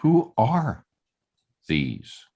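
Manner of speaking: a reading that puts the weight on who; stress on who. Shown as a demonstration. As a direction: lift "Who are" and let "these" sink away. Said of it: "But maybe the emphasis was".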